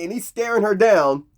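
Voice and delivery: usual speaking voice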